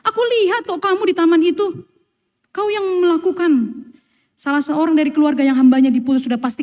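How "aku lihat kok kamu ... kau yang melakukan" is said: accusing relative. Retold or imitated.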